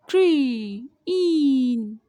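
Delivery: Green drawn out very slowly; slow teacher model of green; slow stretched word. Shown as a demonstration. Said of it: "word modelled, then repeated".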